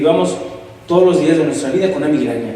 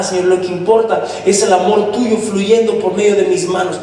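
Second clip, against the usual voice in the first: fervent prayer; 4 semitones higher than usual. Acted. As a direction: pray over him, rising in fervour. Brother prays aloud for youth.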